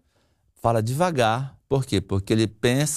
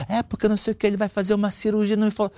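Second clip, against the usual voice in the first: wife imitated; 9 semitones higher, faster than usual. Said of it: "Tininha falou".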